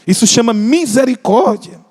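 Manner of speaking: ordinary delivery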